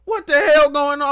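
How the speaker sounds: very high, loud retold outburst of alarm; shocked yell. Bystander in hospital as thief runs out, shocked yell. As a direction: act out yelling in disbelief.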